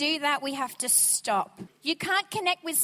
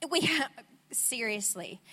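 ordinary talk

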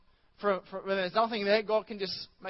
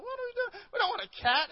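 much higher, hammed-up panic; mock panicked squeal; 14 semitones higher than usual. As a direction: squeal it in a fake tiny voice. Mock panic over cat.

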